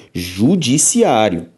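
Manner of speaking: judiciário stretched slowly, syllable by syllable; slowly drawn out. Shown as a demonstration. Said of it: "Aproveito para comparar também a palavra".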